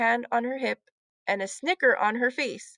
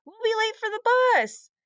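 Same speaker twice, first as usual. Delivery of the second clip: higher call, hurrying him; urgent shout; 11 semitones higher, 4 dB louder than usual. A narrator's reading. Heidi hurrying Henry to the bus, urgent call. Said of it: "Heidi shouted to him".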